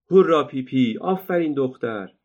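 put-on children cheering Pippi loudly; excited cheering. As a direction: cheer her on with joy. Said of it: "بلند و پرهیجان فریاد کشیدند".

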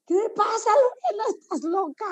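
mother scolding her daughter over her screaming, imitated high; scolding mom. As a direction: mimic mom asking if she's lost it. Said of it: "mi mamá empieza así de".

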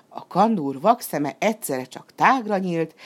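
ordinary delivery

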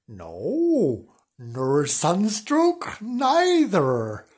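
a lightly voiced Mister Wonks, drawn out very slow; slow, odd-sounding Mister Wonks voice. Mister Wonks replies to Flange.

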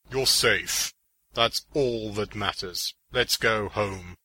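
slow, reassuring hero voice; lightly voiced hero, taking his time; Green Arrow. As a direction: reassure her slowly, in character.

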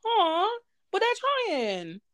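high, soft, drawn-out pity; slow, high "aww" voice; cooing sympathy. Acted. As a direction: coo over them like poor little things.

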